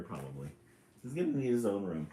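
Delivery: ordinary delivery, nothing marked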